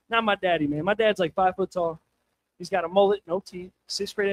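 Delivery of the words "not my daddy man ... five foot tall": usual speaking voice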